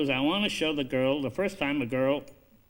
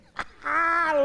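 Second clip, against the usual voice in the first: high laugh; 6 dB louder than usual. Retold or imitated. Lincoln laughing at the scene.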